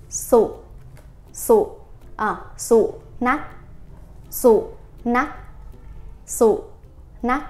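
"sụ nắc" with a short, abrupt vowel, each word cut off; short vowel demo. Shown as a demonstration. Teacher drilling the short vowel in "sụ nắc" slowly. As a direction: clip the vowel in "sụ" short and hard. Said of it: "âm ngắn".

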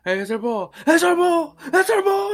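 rising into a high, loud mock yell; imitating a yell. LeBron spotting his team's ball, imitated rising yell. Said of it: "he's yelling".

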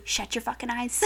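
low, soft warning in bed; retold secretive order; hushed command. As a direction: hiss it under the breath to a bedmate. Retold order to bedmate at night.

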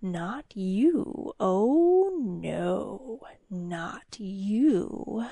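soft, slow, sinister demon taunt; hissing creature. A narrator's reading. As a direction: hiss it softly, savoring the mockery. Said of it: "the creature hissed".

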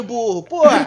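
Shrek voice. Retold or imitated. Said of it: "Shrek do Bussunda".

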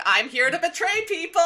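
high, loud gleeful villain line. Retold or imitated. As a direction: announce the betrayal with glee. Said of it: "Sideways was like".

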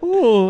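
high, loud teasing ooh; a drawn-out ooh of surprise; mock ooh. The council backing off, high teasing ooh. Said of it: "They're all like".

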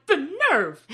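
high, indignant put-on voice; mock outrage at the boyfriend; offended girl. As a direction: huff it out. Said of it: "She's like".

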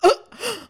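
loud, high gasp; sharp audible gasp of shock. Real, not acted. Co-host hearing of the Zanzibar anthem, shocked gasp.

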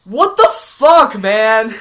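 loud, high, slow disbelief; incredulous outburst. Real, not acted. Podcaster reacting to the bad joke with loud, high disbelief. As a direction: blurt out the disbelief, drawn out. Outraged at the show.

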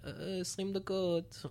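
imitating a radio traffic reporter. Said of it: "שהם אומרים כזה".

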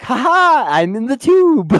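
high, loud gleeful mock laugh; hammed-up triumphant sing-out; Demetria. Demetria gloating from inside the tube, hammed-up glee. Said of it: "And then Dimitri is like".

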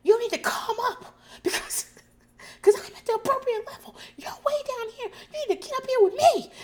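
very high, loud reenacted screaming; screamed. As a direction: scream it back high-pitched, mimicking an outburst. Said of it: "and I screamed".

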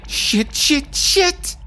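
high-pitched, rapid-fire panic; panicked cursing. Acted. Traveler just picked for a security search, panicking. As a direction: curse in a rising alarm.

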